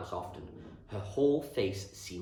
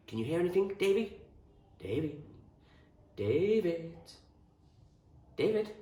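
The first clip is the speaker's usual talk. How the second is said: higher, rising questioning calls; calling to a friend; 9 semitones higher than usual. A narrator's reading. Tucky calling David, who has fallen asleep, questioning. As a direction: call his name, waiting for an answer.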